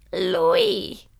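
high, breathy cry of welcome; rapturous call. A narrator's reading. Aunt Fan greeting mama with a high rapturous cry. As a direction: greet her like a heavenly vision. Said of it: "kiáltotta".

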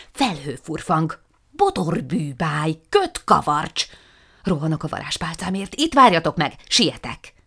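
a flustered witch casting nonsense magic; excited witch incantation. Acted. Matild chants a spell and dashes off for her wand, loudly.

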